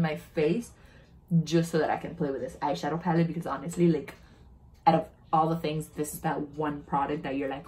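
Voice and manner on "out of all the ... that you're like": ordinary talk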